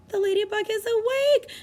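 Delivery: high delighted announcement; excited cheer. Pretend. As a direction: cheer for the bug. Ladybug wakes.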